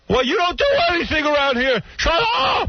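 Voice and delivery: high, ranting mock-furious dad; yelling father. Father berating his idle son, imitated furious yelling. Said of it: "he'd start yelling at me".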